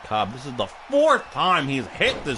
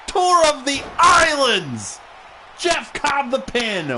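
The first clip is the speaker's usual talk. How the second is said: dramatic call; 12 semitones higher, 5 dB louder, slower than usual. Acted.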